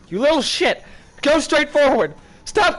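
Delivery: high, loud scolding shout; exasperated shouting; frustrated yelling. Real, not acted. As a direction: bark orders at it. Yelling at misbehaving puppet.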